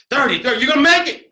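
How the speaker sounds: high, loud rallying shout; shouted pep talk mid-rescue; urgent encouragement. Acted. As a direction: urge the patient to hang on. Acts out encouraging the patient.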